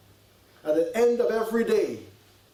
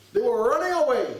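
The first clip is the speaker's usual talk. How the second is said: laughing mockery; 3 semitones higher, 3 dB louder than usual. Real, not acted. Mocks fleeing enemy soldiers.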